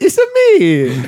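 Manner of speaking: high, drawn-out cartoon Italian accent; mock Italian accent. Host teases co-host as an Italian, mock Italian accent. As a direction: stretch it out in a hammed-up Italian accent. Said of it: "You're Italian. You're like".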